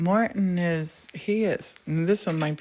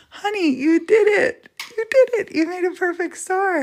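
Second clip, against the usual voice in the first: high, loud squeals of delight; thrilled praise; 13 semitones higher, 6 dB louder than usual. Real, not acted. Wife gushing to husband over his perfect star, squealing with delight. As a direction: gush over the star he made.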